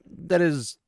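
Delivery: halting and drawn out, fumbling for words; flustered backpedaling. Retold or imitated. He tries not to insult her, flustered. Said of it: "try not to insult her".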